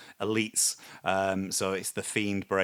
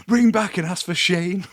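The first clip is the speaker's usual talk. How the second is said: egging on; 10 semitones higher, 10 dB louder than usual. Retold or imitated. Friends egging him on.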